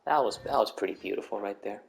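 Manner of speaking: ordinary talk, nothing marked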